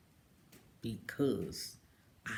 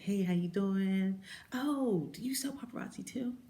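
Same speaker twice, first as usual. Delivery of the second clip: higher, chirpy inquiry; friendly message; 8 semitones higher, 5 dB louder than usual. Retold or imitated. Voicing a Facebook message from a stranger, high and chirpy. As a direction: read the fan message brightly. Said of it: "little messages on Facebook".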